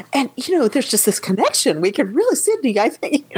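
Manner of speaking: imitating an excited man, pitch lifting. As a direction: act out someone eagerly sharing a new connection. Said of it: "He was so excited".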